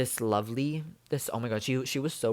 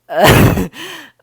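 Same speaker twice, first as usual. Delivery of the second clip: short, high, loud laugh; loud high-pitched laugh; burst of laughter; 13 semitones higher, 14 dB louder than usual. Real, not acted. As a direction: crack up once more. Still laughing mid tip.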